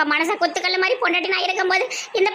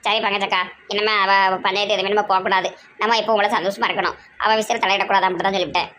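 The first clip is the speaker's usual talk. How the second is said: low, weighty lecturing voice; deep-voiced elder sister; 7 semitones lower than usual. Elder sister warning Pankajam in a deep voice. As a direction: lay down the law from low in the chest.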